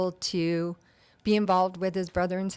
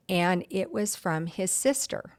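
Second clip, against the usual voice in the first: ordinary talk, nothing marked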